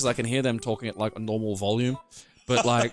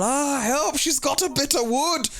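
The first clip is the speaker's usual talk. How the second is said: high, hammed-up Scottish wail; thick Scottish accent; 13 semitones higher, slower than usual. Big Scottish boyfriend fleeing his tiny girlfriend, mock high-pitched Scottish wail. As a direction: cry for help in mock Scottish panic. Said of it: "this thick Scottish accent going".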